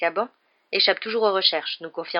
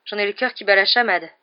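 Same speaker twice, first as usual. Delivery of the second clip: usual speaking voice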